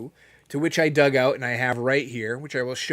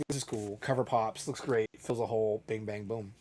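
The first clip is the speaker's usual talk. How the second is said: quiet self-talk; 4 semitones lower, 7 dB quieter than usual. Retold or imitated. His own inner thought, quoted.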